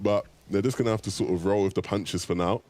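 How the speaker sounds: ordinary talk, nothing marked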